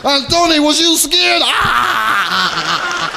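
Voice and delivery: high mock-mobster tease into a guffaw; teasing mobster. Mobster asks Tony was he scared, hammed-up amused tease. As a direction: tease your pal, then burst into a drawn-out "ahh". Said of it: "he started laughing".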